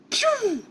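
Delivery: high-pitched imitated laser "pew"; beam sound effect. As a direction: mimic a blast zipping out.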